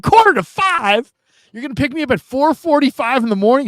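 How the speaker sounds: put-on young player stunned by a pre-dawn pickup, high and incredulous; shocked disbelief. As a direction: gasp it out like you can't believe it. Young self shocked at early pickup.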